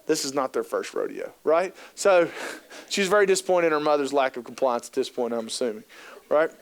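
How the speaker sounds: normal talking voice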